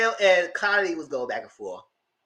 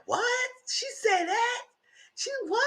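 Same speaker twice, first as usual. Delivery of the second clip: very high, softer incredulous squeak; shocked disbelief; 5 dB quieter, slower than usual. Retold or imitated. Orla hearing what the agent said, shocked and high. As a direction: sound stunned.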